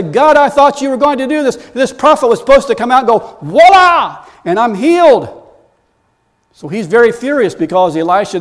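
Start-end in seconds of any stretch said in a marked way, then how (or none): 0.0-5.4 loud, high mock complaint to God; frustrated, upset. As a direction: protest like someone let down, peaking on the exclamation. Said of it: "we get frustrated and upset and say".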